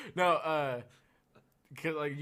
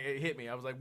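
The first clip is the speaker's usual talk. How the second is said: normal talking voice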